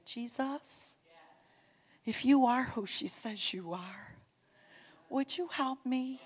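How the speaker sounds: quiet, tentative plea to Jesus; soft pleading prayer. Retold or imitated. Tormented friend asks Jesus for help, soft imagined prayer. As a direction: pray it softly, as if in need.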